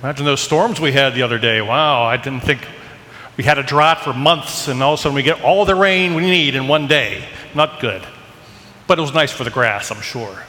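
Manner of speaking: ordinary talk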